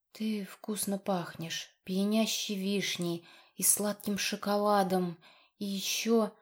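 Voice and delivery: slow, breathy intimate whisper. Acted. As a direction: whisper it close and slowly. Intimate whisper close to his neck.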